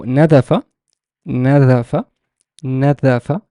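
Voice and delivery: nadafa pronounced carefully, rising at the end; teacher modelling nadafa distinctly; clear letter demonstration. Shown as a demonstration. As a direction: sound out nadafa with emphasised vowels. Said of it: "see how the letter is pronounced".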